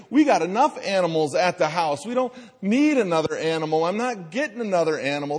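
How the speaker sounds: a light take on a stubborn father refusing the cat; dismissive dad. Retold or imitated. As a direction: wave the kids off, quickly. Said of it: "The dad's like".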